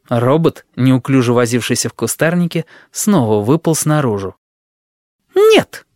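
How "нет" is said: high, loud flat refusal; sharp loud no. Acted. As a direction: shoot it down at once. Sheila refuses proposal.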